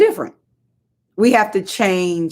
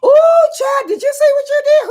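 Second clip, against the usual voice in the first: gushing admirer; 6 dB louder than usual. Retold or imitated. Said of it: "Somebody be like this".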